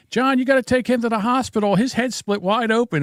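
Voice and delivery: high, urgent woman telling the dad; urgent neighbor lady. Retold or imitated. Neighbor urging the dad to the hospital, high urgent voice. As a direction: press him to go now, voice up high. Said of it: "she's like".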